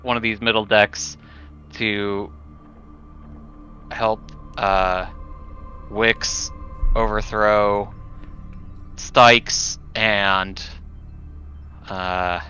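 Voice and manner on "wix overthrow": usual speaking voice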